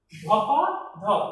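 ধপা ধপ said slowly, ধপা with the added আ; slow demo. Shown as a demonstration. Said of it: "এই যে আকারটা এসেছে". (ধীরে ধপা ধপ)

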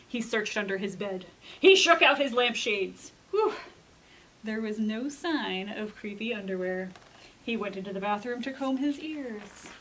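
lively picture-book reading with dramatic swings; storytime narration. A narrator's reading. Librarian reading a picture book to kids, storytime voice. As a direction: read it to a group of children, playing up the scary parts.

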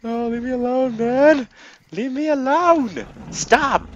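high, loud pleading shout; exasperated high yelling; yelled protest. Real, not acted. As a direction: holler at a pursuer to back off. Yelling at pursuing game enemy.

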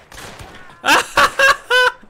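loud, very high burst of laughs; high cackling laughter. Real, not acted. Player laughing at something so stupid, high loud cackle. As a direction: cackle helplessly.

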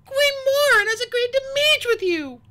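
loud, very high, urgent plea; a little desperate. A narrator's reading. Sunny offers the queen a meeting, a little desperately. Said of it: "a little desperately".

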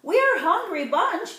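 usual speaking voice

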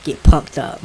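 loud hyped call; hyped shout. Real, not acted. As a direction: shout it out, pumped. Hype as the attack lands.

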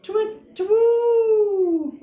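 high, hooting twit-twoo; owl call. Acted. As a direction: hoot it up high like an owl at night. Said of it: "went the owl".

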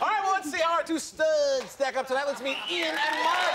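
high, excited game-show announcer; show host intro. Pretend. Host presents two studs to the audience, big announcer voice. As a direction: draw out the contestants' names for the crowd.

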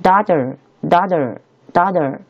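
American "daughter" with a flapped d-like t; slow "dah-der" for "daughter", American style; American "daughter", open vowel and curled final r; American accent. Shown as a demonstration. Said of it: "美式发音读作".

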